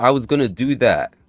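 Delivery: ordinary talk, nothing marked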